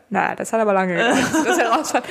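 laughing. Real, not acted. Laughing aside while reading.

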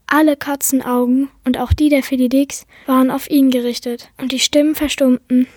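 plain reading voice

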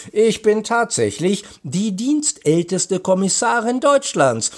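high, animated woman's character voice; playful commissioner. A narrator's reading. Commissioner boasts to the boys, playful. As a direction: say it high, as if with a wink. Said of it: "Sie zwinkerte den Freunden zu".

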